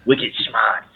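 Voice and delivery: hint of a Boston accent. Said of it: "we'd have to do a Boston accent".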